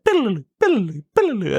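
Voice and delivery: high sing-song flashback noise; playful high-pitched mock jingle. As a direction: chirp it like a cartoon transition. Flashback sound effect imitated.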